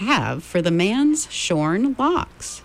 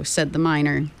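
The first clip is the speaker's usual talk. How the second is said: usual speaking voice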